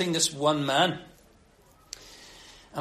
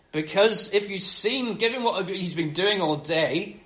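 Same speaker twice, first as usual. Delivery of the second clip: ordinary delivery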